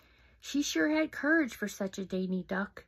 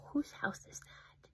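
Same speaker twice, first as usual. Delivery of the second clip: hushed wonder; 5 semitones higher, 8 dB quieter than usual. Acted. Reader asking children about the picture in a hushed whisper. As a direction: gasp then whisper. Gasp, whispered question to kids.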